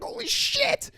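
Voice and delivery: very high startled yelp; mock shock. Retelling the guys in the tunnel, startled yelp. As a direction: yelp in surprise. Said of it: "the guy's like".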